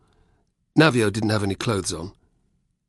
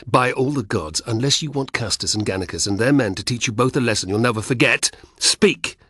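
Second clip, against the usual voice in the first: building to a furious shout; angry; 3 semitones higher than usual. Acted. Spartacus demands an answer.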